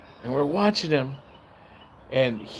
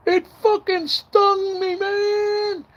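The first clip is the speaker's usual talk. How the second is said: much higher, crying voice of the stung man; pained whimper; 4 dB louder, much slower than usual. Retold or imitated. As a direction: whine it through the pain.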